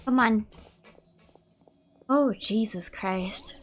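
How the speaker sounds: ordinary talk, nothing marked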